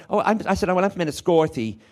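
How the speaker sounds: put-on high, lively County Wexford accent; slipping into the home accent; changed home accent. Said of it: "That your accent".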